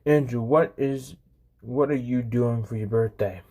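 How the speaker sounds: usual speaking voice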